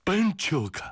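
very high, startled cry of recognition; joyful startled cry; sudden cry of surprise. A narrator's reading. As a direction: shout his name in joyful shock. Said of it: "突然惊叫了起来".

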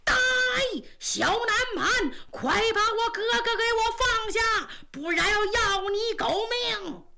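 愤怒高喊; enraged shout. A narrator's reading. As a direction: bellow the threat to free his brother. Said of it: "就听有人高喊".

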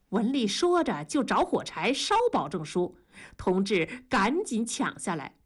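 ordinary delivery, nothing marked

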